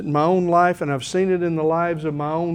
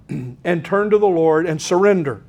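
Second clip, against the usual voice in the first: ordinary talk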